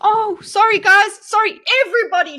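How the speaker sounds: loud, high excited shout; real over-the-top shouted apology; yelling at chat. Real, not acted. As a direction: yell the apology across the room. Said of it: "I lost viewers just from yelling".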